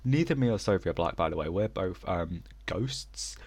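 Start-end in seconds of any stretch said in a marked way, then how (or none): none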